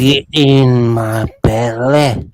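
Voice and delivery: slow, loud growling belly line; Fat Bastard voice. Retold or imitated. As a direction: drawl it like a hungry brute. Quoting famous line, crying.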